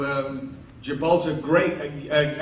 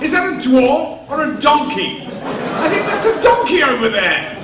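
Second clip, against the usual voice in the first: very high, loud astonished shouts; shocked drunk star; 11 semitones higher, 9 dB louder, slower than usual. Retold or imitated. O'Toole breaking off his speech, shouting in amazement. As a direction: lose the line in sheer disbelief.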